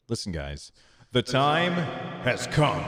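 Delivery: slow, weighty build-up to the reveal; dramatic announcement. Shown as a demonstration. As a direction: build suspense on TIME and COME.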